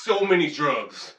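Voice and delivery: slow, leaning on "So many drugs"; emphatic repeat. Pretend. Repeats the joke slower.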